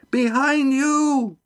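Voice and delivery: very high, drawn-out warning cry; shout. A narrator's reading. Lindy warning Estrella, high drawn-out shout. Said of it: "she shouted".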